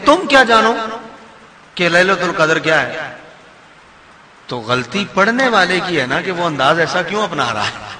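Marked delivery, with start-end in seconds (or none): 0.1-3.2 challenging reading. Shown as a demonstration. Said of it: "یوں بھی پڑھ سکتے ہیں".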